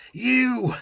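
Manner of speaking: high, loud shriek; a piercing cry of rage. Acted. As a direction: shriek the word at him. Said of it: "He shrieked".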